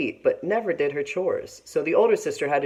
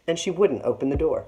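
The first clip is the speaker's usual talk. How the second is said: usual speaking voice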